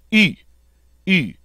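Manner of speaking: high "E" through rounded lips; French u, not oo: "E" with rounded lips. Shown as a demonstration. Teacher corrects Americans' oo, rounded-lip E. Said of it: "a long E sound spoken through rounded lips".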